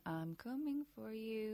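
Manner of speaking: slow, hushed creepy threat; menacing Fearsies. Acted. The Fearsies standing in the corner threatening her, hushed menace. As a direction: draw it out softly, like a lurking bogeyman.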